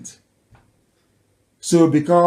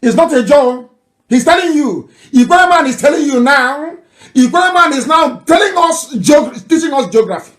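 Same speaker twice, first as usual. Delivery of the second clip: much higher and louder, shouting in triumph; excited shout; 8 semitones higher, 5 dB louder than usual. Real, not acted. Commentator cutting in over the voice note, shouting excitedly. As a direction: shout it out like a victory.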